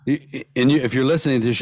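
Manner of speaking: strained, worn-out voice; hoarse from talking all week. Real, not acted. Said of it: "So now my voice is all fucked up".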